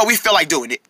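ordinary delivery

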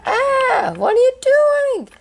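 high, loud terrified cries for help; a frightened child shrieking at a captor; panicked screaming. Acted. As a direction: scream like you're being dragged away. Kidnapped character screaming for help.

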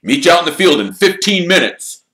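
high, loud, hearty team captain; rally the team with gusto. Acted. Wood continues.

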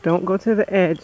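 usual speaking voice

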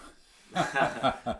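sudden high laugh; bright burst of laughter. Real, not acted. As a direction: laugh out loud, amused. Laughs at milk in tea.

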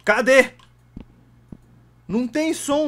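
loud, high, annoyed call; frustrated shout. Real, not acted. Streamer yells at the game, frustrated shout. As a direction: shout it out, fed up.

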